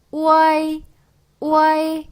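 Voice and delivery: 우아이 as one long high glide; pronunciation demo. Shown as a demonstration. Teacher showing learners how to say it, pronunciation demo. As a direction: hold 우아이 on a level tone, u through a to i. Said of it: "이렇게 발음을 한다는 거예요".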